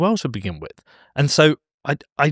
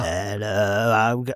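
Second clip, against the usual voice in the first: zombie voice; 3 semitones lower, 4 dB quieter, much slower than usual. Retold or imitated. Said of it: "we had it before and it went".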